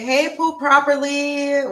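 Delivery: high, bright hello to a viewer; excited welcome. Real, not acted. Host greeting a new viewer in chat, excited. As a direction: call out a happy welcome.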